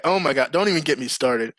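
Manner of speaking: usual speaking voice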